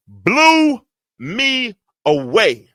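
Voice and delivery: each word stretched long and loud; amazed, stretched-out repeat; slow drawn-out. Acted. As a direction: draw it out slowly for the crowd.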